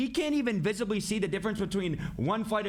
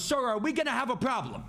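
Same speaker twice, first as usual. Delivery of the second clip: mock-stern flight attendant, pitched higher; retold angry warning; 7 semitones higher than usual. Attendant confronting a drunk passenger, retold angry warning. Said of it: "like, angry".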